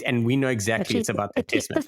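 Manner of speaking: ordinary delivery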